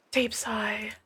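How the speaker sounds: soft, breathy whisper; whispered stage direction. Acted. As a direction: sigh the words out under your breath. Reading the Wraith's stage direction.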